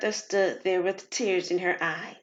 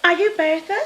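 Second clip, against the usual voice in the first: put-on higher, louder young visitor asking; a pretty young woman checking she has the right person; young woman's voice; 8 semitones higher, 5 dB louder than usual. Young woman asks Bertha at the salon, higher and louder.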